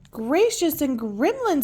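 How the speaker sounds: high, slow, surprised gasp of words; surprised exclaim. Acted. Miss Futterby seeing the animal parade, exclaiming high and slow. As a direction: exclaim in astonishment. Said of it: "in surprise".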